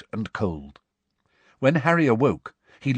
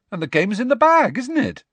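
high, loud encouragement; high-pitched reassuring bluff; forced cheer; 13 semitones higher, 5 dB louder than usual. Acted. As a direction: cover the dismay with optimism. Harry forces cheer after bad news.

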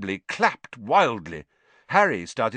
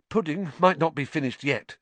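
raised, hopeful note; 6 semitones higher than usual. A narrator's reading. Ron leading the way to the feast, hopefully. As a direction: say it with hope, pitched up. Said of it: "said Ron hopefully".

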